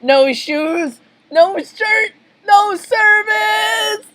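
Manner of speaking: rising shout, last word held long; triumphant climbing holler; battle cry. Acted. Battle cry while firing cannon.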